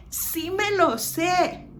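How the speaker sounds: high-pitched insistence on Sí; emphatic SÍ. Shown as a demonstration. Teacher gives the Spanish equivalent, high insistent Sí. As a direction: protest it, leaning on Sí. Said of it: "No digas que no me lo sé".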